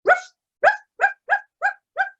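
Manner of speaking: high yapping ruff ruff; dog barking. Acted. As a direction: bark like a dog. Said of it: "he began barking".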